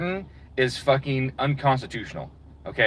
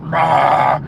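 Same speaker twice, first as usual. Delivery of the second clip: loud, hammed-up beastly grunt; mock animal growl; 7 dB louder than usual. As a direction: bark it like a caveman over a plate.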